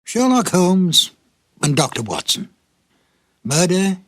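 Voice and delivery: normal talking voice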